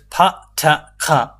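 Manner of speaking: the three sounds said one by one, aspirated; smart sounds demo. Shown as a demonstration. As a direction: spit out each sound clearly. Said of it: "three smart sound".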